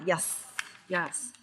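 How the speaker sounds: ordinary delivery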